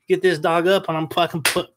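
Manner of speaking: ordinary talk, nothing marked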